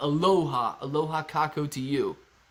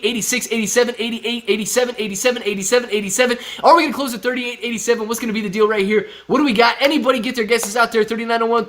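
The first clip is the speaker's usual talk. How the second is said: auctioneer; 5 semitones higher, 6 dB louder, faster than usual. Acted. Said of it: "Sounds like a fast-talking auctioneer".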